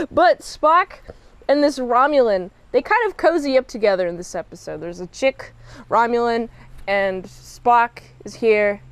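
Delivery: normal talking voice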